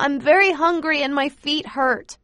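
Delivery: put-on high child's voice calling out loud; a tired little girl calls to Pa; whiny child shout, hungry and sore; child yelling to father. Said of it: "I yell to him".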